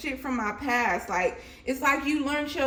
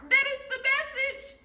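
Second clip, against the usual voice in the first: emphatic exclaim; 11 semitones higher, 4 dB louder than usual. Real, not acted. Scene: reader insisting to viewers the card keeps repeating, high and emphatic. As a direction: hammer the message home.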